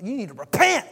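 hammed-up Southern hellfire preacher, loud and spitting; Southern accent. Preacher parodying the harsh sound of repent, mock Southern accent. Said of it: "it's always in a Southern accent".

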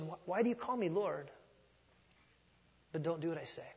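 honest, piercing question. Shown as a demonstration. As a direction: ask it quietly, like an honest question. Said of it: "piercing and an honest evaluation".

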